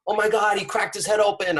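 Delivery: high, loud alarmed cry, imitated; panicked bystander. Bystanders freaking out over fallen man, imitated high panicked cry. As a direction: mimic a bystander shrieking in shock. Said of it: "freaking out and, like".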